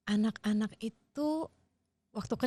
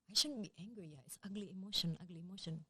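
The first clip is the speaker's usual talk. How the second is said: softer and softer, fading away; startled child's inner voice; 11 dB quieter than usual. Retold or imitated. Startled child telling herself off, softly fading out. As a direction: let the child shrink into herself. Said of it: "anak itu jadi kaget dan jadi".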